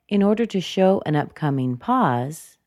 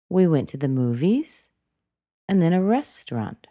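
"movies" swept up, then a pause; rising intonation. Shown as a demonstration. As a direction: lift the end of "movies" like a question, fall on "restaurant". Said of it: "went up".

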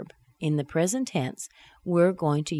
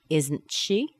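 "Isn't she" with "she" jumping up; held T, then "she" rising; rising intonation. Shown as a demonstration. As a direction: let "she" swing up as a question. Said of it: "let's let the intonation fall down".

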